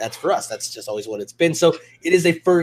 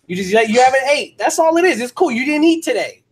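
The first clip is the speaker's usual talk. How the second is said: high, loud mock comfort for a hungry friend; high hammed-up consoling to a salty guy; mock soothing; 11 semitones higher, 6 dB louder than usual. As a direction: coo it way up like calming a cranky kid. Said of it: "I'm like".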